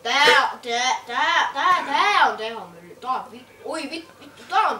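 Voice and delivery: loud, eager repeated tää; excited stammer. Real, not acted. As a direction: blurt it out, thrilled. Excited about car in game. (innostunut änkytys)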